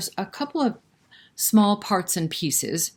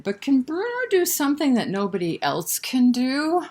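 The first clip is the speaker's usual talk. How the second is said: challenging question; 7 semitones higher than usual. Acted. Character challenges Bruno.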